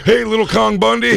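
high, mock-tender Hogan voice. Friend voices Hogan greeting his dog, in a high mock coo.

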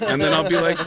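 ordinary delivery

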